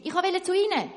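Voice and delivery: high, loud call. Acted. Doctor calling to the shoemaker, loud call. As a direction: keep calling across. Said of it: "ruft eine andere Stimme".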